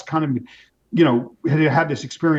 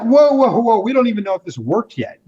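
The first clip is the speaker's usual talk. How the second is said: high, urgent "whoa, whoa" to halt someone; retold alarmed protest; 9 semitones higher, 4 dB louder than usual. Friend cutting off the wife's early tears of relief, alarmed.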